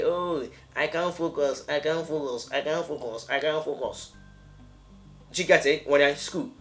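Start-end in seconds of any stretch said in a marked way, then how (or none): 0.0-4.1 put-on whiny student girl. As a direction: imitate a distracted girl who can't study. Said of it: "you hear this lady say".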